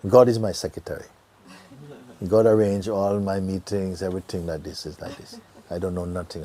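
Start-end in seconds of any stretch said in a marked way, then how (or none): none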